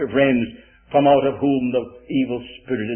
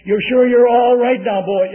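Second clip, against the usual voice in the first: raised, high call to a sleeping son; loud, high check-up from a mother; mother calling insistently; 10 semitones higher, 10 dB louder than usual. Retold or imitated. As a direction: call it out to wake the boy.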